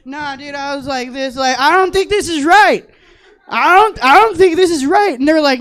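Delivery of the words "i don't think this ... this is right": loud, higher alarmed protest; panicked protest. Retold or imitated. Numb from head down, panicking at staff. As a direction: insist in rising panic.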